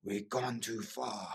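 put-on slow, soft, ominous Dutch-accented warning; a hint of a Dutch accent, drawn out slowly; Wim Hof's Dutch voice. Wim Hof in a vision warning the climber, slow mock Dutch accent. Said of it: "really loud Dutch voice go".

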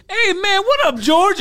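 high, exuberant greeting; Peter. Retold or imitated. Friend greeting George at the airport, high exuberant impression. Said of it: "And Peter's like".

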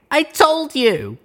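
high sobbing wail; sobbing cry. A narrator's reading. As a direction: cry it out desperately. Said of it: "She sobbed".

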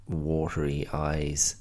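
ordinary talk

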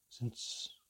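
breathy fading whisper on "since"; the word dies away unfinished; whispered trailing off. Acted. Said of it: "he whispered".

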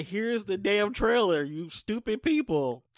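slow, sneering, mocking studio voice; cynical. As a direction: sneer it out at the audience. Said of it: "said that with a little cynicism".

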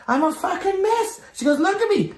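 very high imitated self-reproach; mock distraught apology. Host apologising to the comic onstage, mock high-pitched distress. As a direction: act out a host falling apart, voice pitched right up.

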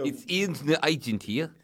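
put-on phone caller with a South African accent.

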